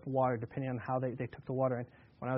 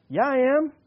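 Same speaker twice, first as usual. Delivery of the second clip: firing back; 9 semitones higher, 9 dB louder than usual. Retold or imitated. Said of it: "he could have fired back".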